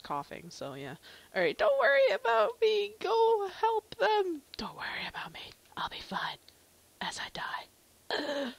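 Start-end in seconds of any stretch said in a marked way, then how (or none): 1.3-4.4 urgent game character. Acted. Injured villager urges player to go help them, hammed-up urgent shout. 4.6-8.5 dying character. Acted. Coughing villager tells player not to worry, mock dying whisper.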